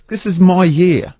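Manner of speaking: announcing your comeback to the host; triumphant boast. Real, not acted. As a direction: crow about your big year. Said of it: "[name] is back, really".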